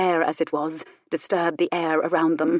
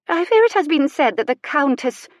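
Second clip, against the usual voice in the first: high, louder matronly voice; acted prim hesitation, raised pitch; reluctant disclosure; 9 semitones higher, 5 dB louder than usual. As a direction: break delicate gossip with careful reluctance. Prim matron breaks delicate gossip.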